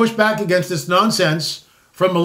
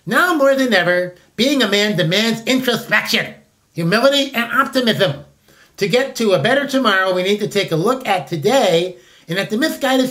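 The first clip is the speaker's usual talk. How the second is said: lightly pompous reading of the mission text; a hint of mocking official announcement. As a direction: read it like a solemn press statement. Reads out official day statement.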